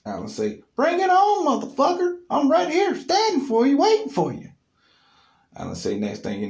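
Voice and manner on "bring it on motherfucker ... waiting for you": very high, loud hammed-up dare; defiant taunt. Alan daring the ex-boyfriend to fight, high loud taunt. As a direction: yell the dare right back.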